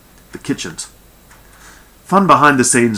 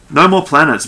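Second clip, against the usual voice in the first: loud, higher-pitched irritated command; growled order; 7 semitones higher, 7 dB louder than usual. A narrator's reading. Barducci cutting off Norell's planet request, growled order. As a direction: shut the subject down hard. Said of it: "Barducci growled".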